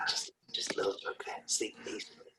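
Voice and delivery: very soft breathy whisper; acted bedtime hush; whispered backtracking. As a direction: whisper it apologetically to someone dozing off. Acting whispering to sleeping partner.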